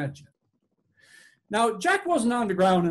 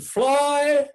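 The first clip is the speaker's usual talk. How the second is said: loud, higher, stretched-out call; shouted command; 5 semitones higher, 5 dB louder than usual. A narrator's reading. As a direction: shout the magic word to launch the punt. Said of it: "He shouted".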